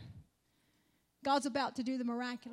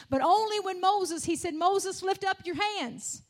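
raised, impassioned preaching voice; fervent preaching; 2 semitones higher, 5 dB louder than usual. Acted. Preacher rousing the church about the Red Sea, loud and fervent. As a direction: drive it home to the congregation.

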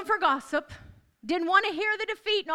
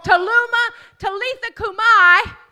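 commanding call; 4 semitones higher, 10 dB louder than usual. Acted. Jesus commanding the dead girl, high and loud. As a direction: call her back with authority.

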